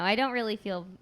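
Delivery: usual speaking voice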